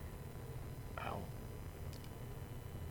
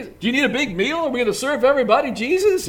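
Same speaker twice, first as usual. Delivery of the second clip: higher, louder eager questions; Martha asking Jesus; 9 semitones higher, 6 dB louder, faster than usual. Acted. Martha eagerly asking Jesus about the meal, higher and louder. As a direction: rush the questions at Jesus, keen.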